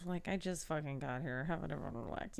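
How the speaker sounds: soft low mumble; sleepy grumble. Retold or imitated. Channing refuses to get up, sleepy and soft. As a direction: grumble it half asleep.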